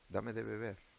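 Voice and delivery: soft, gentle asking; polite, soft request for a drink. Shown as a demonstration. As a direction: ask kindly and softly. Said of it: "le hablaría en buen término".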